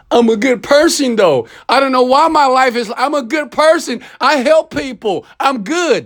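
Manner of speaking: high, whiny sing-song take-off of a smug braggart; mock them in a high, self-pitying voice; mocking a self-satisfied person. Speaker mocks listeners bragging they're good people, in a high, whiny mock voice. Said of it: "So why are you s-, bragging about".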